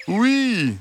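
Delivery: high, gentle fox. A narrator's reading. Fox reassuring the hens, high and sweet.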